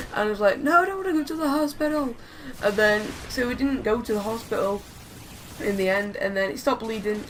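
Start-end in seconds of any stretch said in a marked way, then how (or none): 0.6-2.2 put-on higher-pitched childlike whine; mock whiny protest. Hurt kid told he needs the hospital, mock whiny refusal.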